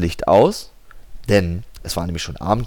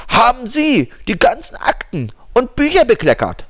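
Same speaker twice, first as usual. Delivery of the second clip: stern lawyer; 13 semitones higher, 4 dB louder than usual. Acted. Lawyer questions secretary.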